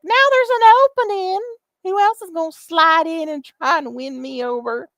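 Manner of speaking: high, loud, hammed-up come-on; mock flirty invitation. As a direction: put on a shrill flirty sales pitch to her fans. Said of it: "appeal to her other simps and be like".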